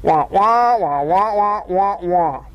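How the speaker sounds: muffled wah wah of the cartoon teacher; Charlie Brown teacher wah wah. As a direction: drone wordless wah wah like a muffled trumpet. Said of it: "the teacher talks".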